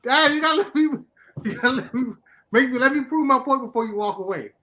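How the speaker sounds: high, urgent begging to be heard out; pleading. Real, not acted. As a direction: plead with him not to walk away. Begging friend to hear him out.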